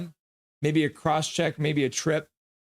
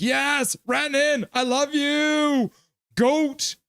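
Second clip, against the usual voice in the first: "Yes, Rantanen, I love you" squealed much higher, slow; gushing fan; 13 semitones higher, much slower than usual. Retold or imitated. Voicing a fan's chat, high excited swoon. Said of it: "Bunny Van's like".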